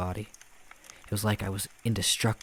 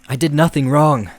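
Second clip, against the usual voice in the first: high, loud pleading scream; desperate scream; 7 semitones higher, 15 dB louder than usual. A narrator's reading. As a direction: scream it in terror. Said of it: "I screamed".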